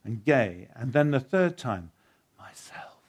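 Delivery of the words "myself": barely audible whisper; soft whisper. Acted. The lion answers Shasta a third time in a barely audible whisper. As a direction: breathe it so softly it's almost lost. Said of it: "whispered so softly you could hardly hear it".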